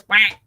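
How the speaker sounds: short imitated penguin quack. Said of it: "and the penguin goes".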